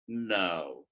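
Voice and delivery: "no" held very low and level. Shown as a demonstration. Teacher proving English has tones, low level "no". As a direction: drop "no" to the bottom of the voice, no movement. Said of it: "You have tone there".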